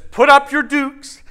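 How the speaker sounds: high, loud challenge; blustering lion. Acted. Lion jumps into their path, loudly blustering. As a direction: burst out like a lion spoiling for a fight. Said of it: "out from the underbush jumps this lion".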